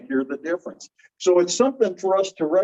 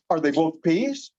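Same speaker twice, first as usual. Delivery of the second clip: ordinary talk, nothing marked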